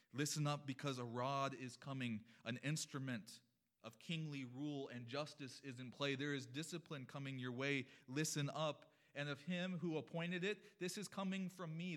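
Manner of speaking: loud, urgent preacher's warning; forceful preaching. Acted. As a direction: thunder out the warning, climbing in pitch.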